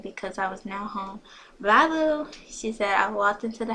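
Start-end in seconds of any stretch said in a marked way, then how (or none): 1.6-2.3 high, loud singsong farewell; playful goodbye. A narrator's reading. Friend says bye at her house, playful singsong. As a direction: call it out in a playful high lilt.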